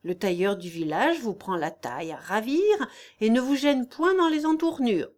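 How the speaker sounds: usual speaking voice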